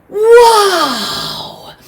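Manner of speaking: high, loud, stretched wow; amazed. Acted. Raven opening the box on the sun, loud amazed cry. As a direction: burst out in wide-open wonder.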